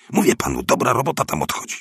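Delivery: high, quick, excited pitch; eager persuasion. A narrator's reading. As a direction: talk him into it with rising excitement. Eager neighbour talking him into it. (podekscytowana, szybka namowa wysokim głosem)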